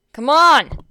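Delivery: loud, angry bark of a shout; growled shout. A narrator's reading. Bakugo yelling at the other team, angry shout. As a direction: snarl it out in open frustration. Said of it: "Bakugo growled".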